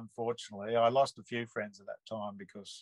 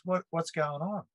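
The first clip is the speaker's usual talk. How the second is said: retold tearful bewilderment; 7 semitones higher than usual. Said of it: "tears welled up in his eyes".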